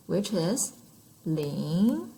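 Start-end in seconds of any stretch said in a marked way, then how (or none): 1.2-2.1 "ling" gliding steadily upward; second tone, rising. Shown as a demonstration. Teacher introducing a second-tone number to learners, rising pitch. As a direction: draw out "ling" with a question-like rise. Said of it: "tone goes up".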